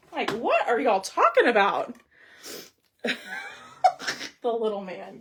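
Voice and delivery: usual speaking voice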